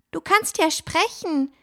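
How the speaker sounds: put-on high, amazed girl's voice; astonished. A narrator's reading. Fine amazed her bird can talk, in a high astonished voice. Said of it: "rief Fine sichtlich erstaunt".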